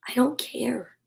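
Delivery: unvoiced sleepy whisper. A narrator's reading. Bear drifting off, whispering to Mouse. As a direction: breathe it, barely awake.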